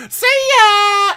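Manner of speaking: high, loud, taunting "see ya"; mocking shout. The flirt ditching the suitor with a high, loud taunt. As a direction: yell the goodbye sharply as you run off.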